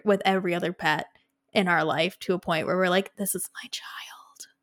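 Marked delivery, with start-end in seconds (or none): 3.2-4.5 whispered, tender and slow; doting whisper. Retold or imitated. Pet owner whispering over her pet. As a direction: breathe it like a secret to a baby.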